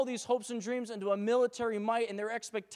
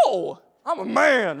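louder, slow mock macho voice; mock tough man; 9 dB louder, slower than usual. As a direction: puff up like a tough guy. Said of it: "I'm like".

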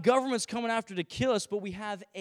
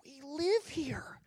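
breathless whispered wonder; awed whisper; 4 semitones higher, 7 dB quieter, slower than usual. Retold or imitated. Driving up Island, awed whisper at the beauty. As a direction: whisper it in awe at the view. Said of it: "you're just like".

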